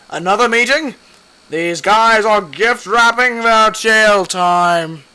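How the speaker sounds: hammed-up gruff comic hero; weird hero voice. Green Hornet grumbling about criminals, slow put-on voice. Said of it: "All of my voices are so weird".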